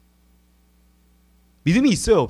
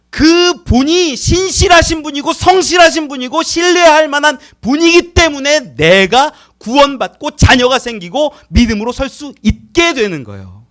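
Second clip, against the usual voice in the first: preaching shout; 5 semitones higher, 9 dB louder than usual. Real, not acted. Preaching climax.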